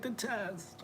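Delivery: high, small mock-panicked voice; imitated panic. Husband imitating wife dreading the nose test, mock panic. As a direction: squeak it softly like someone panicking. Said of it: "she's, like, hyperventilating".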